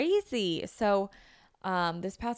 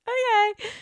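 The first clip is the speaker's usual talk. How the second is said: high, loud strangled okay; squeaky okay; 8 dB louder than usual. Retold or imitated. Hearing it's a blood test, a high scared squeak. Dread at finger prick.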